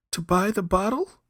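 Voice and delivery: high, loud startled question; startled young man. Acted. Young man stunned by the request, high and loud.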